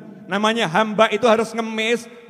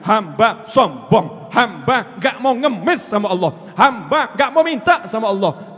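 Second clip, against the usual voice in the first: usual speaking voice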